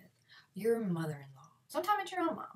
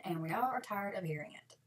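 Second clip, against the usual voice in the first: ordinary talk, nothing marked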